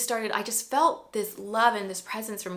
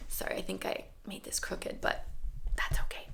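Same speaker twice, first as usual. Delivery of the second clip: whispered aside; 8 dB quieter than usual. Real, not acted. Aside about crooked camera.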